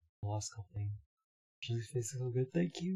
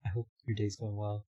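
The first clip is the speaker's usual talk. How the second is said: normal talking voice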